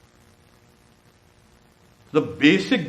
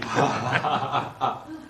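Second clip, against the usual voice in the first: real laugh bursting out after the story; hearty laugh. Real, not acted. Laughs after telling the samadhi story.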